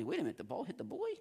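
put-on fast, disbelieving question rising on "boy"; puzzled double-take. Teacher acting a reader thrown by Greek word order, puzzled. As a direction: query it quickly as if it makes no sense. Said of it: "you'll read that very differently".

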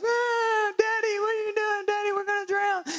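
put-on very high little-kid wail; hammed-up whiny panic; imitating crying child. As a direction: wail to Daddy like a scared child. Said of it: "just the little one's crying".

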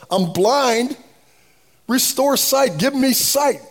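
high, insistent imitated begging; acted plea. Preacher as blind Bartimaeus asking Jesus for sight, acted pleading. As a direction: plead urgently for one specific thing.

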